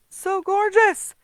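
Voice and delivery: high, loud, slow and drawn out; gushing over her card; delighted gush. Real, not acted. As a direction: swoon over how pretty it is. Admiring her finished piece.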